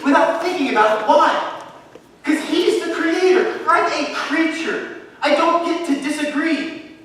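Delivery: high, loud, fervent declaration; full-force preaching. Real, not acted. Pastor declares to his church he would obey at once, fervent shout. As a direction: preach it out with conviction.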